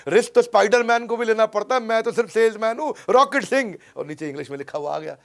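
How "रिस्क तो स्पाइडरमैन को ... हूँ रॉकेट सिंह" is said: film dialogue. Retold or imitated. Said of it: "dialogue".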